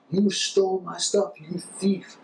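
very high, drawn-out pretend outrage; mock accusation. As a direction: act out a hammed-up public accusation. Mock accusation he warns against.

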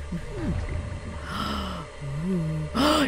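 long, drawn-in gasp of surprise; real gasp at a lucky find; excited gasp. Real, not acted. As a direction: gasp in delighted disbelief. Spots another wanted item.

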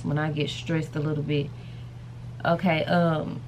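ordinary delivery

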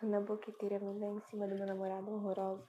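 voz baixa e distante; falando baixo. Real, not acted. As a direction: fala longe do microfone. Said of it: "Tá falando bem baixo". (falando baixinho)

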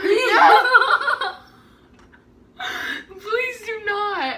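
laughing, pleading no; desperate half-laughing plea; anguished denial. Real, not acted. Sister threatens Miranda Sings makeup.

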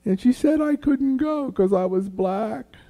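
high-pitched plaintive kid voice, acted out; imitating a tearful child's complaint; upset child. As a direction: tell on a friend like a hurt five-year-old. Crying child reports to mother.

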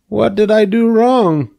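a dazed pig asking what went wrong; puzzled young pig. Acted. Wilbur after his bump, asking Charlotte, high and bewildered.

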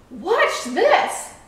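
high, eager announcement; showing off. A narrator's reading. Lighthouse out to impress the boat, showing off. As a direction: announce a trick proudly.

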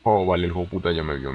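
ordinary talk, nothing marked

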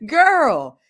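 cracking up as she says it; laughing. Real, not acted.

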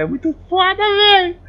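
high, rising exclamation; excited gushing. Real, not acted. Vlogger wishing for a Baiano accent, excited squeal.

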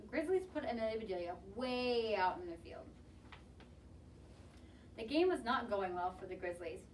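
usual speaking voice

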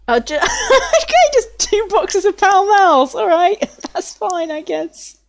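high voice, giggling through the words; laughing. Real, not acted. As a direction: laugh at the silly find. Laughs at cigarette boxes.

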